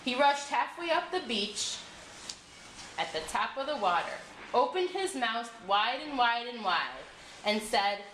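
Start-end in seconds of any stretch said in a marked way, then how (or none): none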